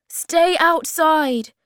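high, loud, slow instruction; calling out. A narrator's reading. Moomin Troll calls back to Hemulin, loud slow call. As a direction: project to someone outside.

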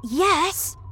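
put-on high, loud, eager boy's yes; thrilled high-pitched exclamation; excited cry. A narrator's reading. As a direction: cry it out with delight. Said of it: "cried Charlie".

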